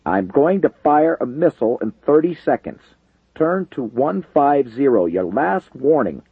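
tense pilot over the radio; strained voice. A narrator's reading. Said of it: "radioed in a strained voice".

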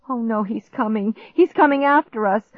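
fearful, building from hushed to high; rising panic. A narrator's reading. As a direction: let dread climb into alarm. Monster approaching, rising panic.

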